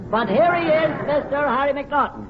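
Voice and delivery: bright, lifted introduction; host introduction. Acted. Host bringing on the next panelist with showman lift. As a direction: present the guest with a flourish.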